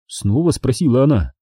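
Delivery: plain reading voice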